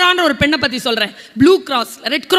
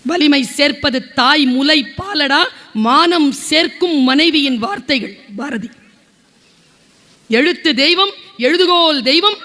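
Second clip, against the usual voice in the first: slow, measured verse recital; poem recitation; slower than usual. Acted. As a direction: recite the lines slowly like a poem.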